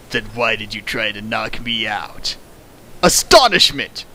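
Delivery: ordinary delivery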